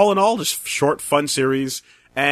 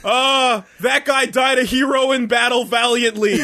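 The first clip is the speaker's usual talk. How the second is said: mock heroic eulogy; 9 semitones higher, slower than usual. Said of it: "And everyone's like".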